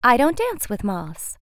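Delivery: haughty butterfly. Acted. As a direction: turn up your nose at him. Butterfly character voice.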